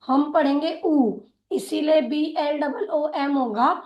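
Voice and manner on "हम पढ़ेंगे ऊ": ordinary talk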